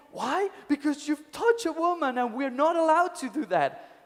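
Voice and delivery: high, loud indignant reply; young monk still fuming at his elder; hammed-up exasperated monk; mock angry. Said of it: "He was really angry at the old monk".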